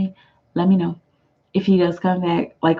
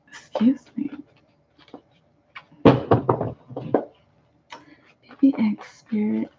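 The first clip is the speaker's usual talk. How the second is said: whisper; 3 semitones higher, 3 dB quieter than usual. Real, not acted. Whispered aside while drawing cards.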